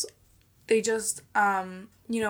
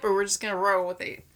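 ordinary talk, nothing marked

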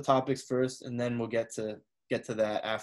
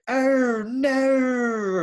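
"Oh, no" pitched much higher, drawn out with an added R; British shocked oh no; 11 semitones higher, 6 dB louder than usual. Shown as a demonstration. Mimicking how British people say "Oh, no" when shocked, added R. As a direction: gasp out "Oh, no" high, adding an R sound. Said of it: "they add, like, R's to it".

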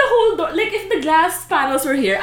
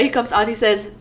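ordinary delivery

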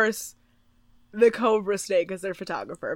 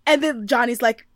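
usual speaking voice

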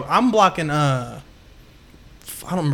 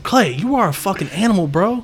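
mild mock pep talk, raised pitch; retold hype-up; 4 semitones higher than usual. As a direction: lightly re-enact hyping up a teammate. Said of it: "I used to always hype him up".

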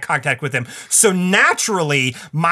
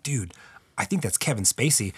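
hushed; 4 semitones lower, 8 dB quieter than usual. Retold or imitated. Friend whispering in a lobby.

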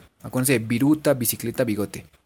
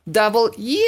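"W" pitched up into an "eww"; hammed-up high yuck; disgust at bitterness; 7 semitones higher than usual. Shown as a demonstration. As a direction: say "W" as if the coffee is horribly bitter. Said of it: "si el café les quedó súper amargo".

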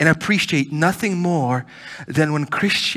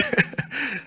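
laugh; 11 semitones higher than usual. Real, not acted. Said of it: "I don't know why I'm laughing".

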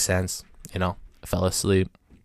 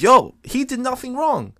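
crowd protest; 12 semitones higher, 3 dB louder than usual. Retold or imitated. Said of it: "It was like".

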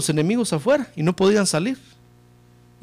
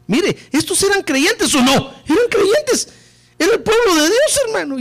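fiery preaching; 13 semitones higher than usual. Acted. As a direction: preach it up to a ringing peak. Preacher peaks to congregation.

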